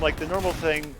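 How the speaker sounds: ordinary talk, nothing marked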